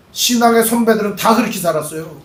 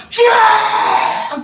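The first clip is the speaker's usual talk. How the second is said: much higher, long drawn-out shout; desperate cry; 12 semitones higher, much slower than usual. Acted. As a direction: wail the word out to heaven. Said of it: "생존을 놓고 기도를 하는 겁니다".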